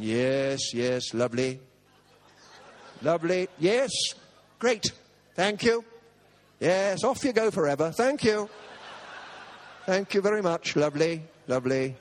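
forced politeness, hurrying her away; eager to get it over with, mock grateful; awkward polite thanks. Preacher being stroked, trying to end it politely, awkward.

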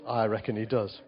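ordinary delivery

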